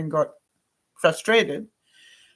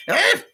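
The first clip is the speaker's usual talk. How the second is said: exasperated cry; 12 semitones higher, 5 dB louder than usual. Retold or imitated.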